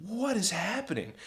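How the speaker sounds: high, soft disbelief; small, high, baffled voice; stunned hushed question. Retold or imitated. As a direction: squeak it quietly in bewilderment. Frozen friends confer in shock.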